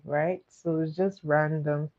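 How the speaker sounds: ordinary talk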